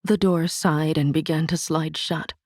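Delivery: usual speaking voice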